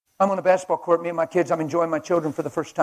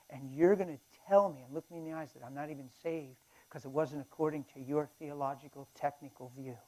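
quiet rebuke; 12 dB quieter than usual. Retold or imitated.

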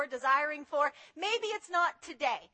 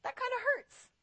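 mock hurt; 7 semitones higher, 4 dB quieter than usual. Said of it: "And he's like".

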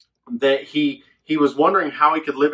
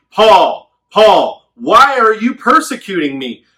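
loud commanding call from above; booming voice; 6 semitones higher, 11 dB louder, slower than usual. Retold or imitated. Jesus calling out loud to Saul on the road. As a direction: boom the name down at him.

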